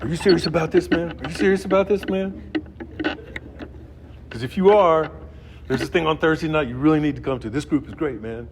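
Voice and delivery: hammed-up eager kid pressing him; imitating the young punk. Young punk urging him to a Thursday meeting, high and pushy.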